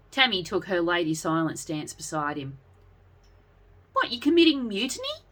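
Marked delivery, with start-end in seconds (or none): 3.9-5.2 a high, shocked outburst; horrified. A narrator's reading. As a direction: recoil at the idea of betrayal. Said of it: "looked around horrified".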